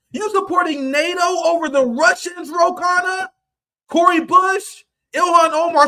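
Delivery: furious roll call of politicians; accusing callout. Real, not acted. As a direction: shout the names one after another. Calling out politicians by name.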